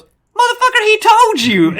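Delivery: very high, loud mock scolding; hammed-up shriek at a buddy; imitated outraged yell. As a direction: holler it like a vindicated heckler. Friend crows after the score, acted out.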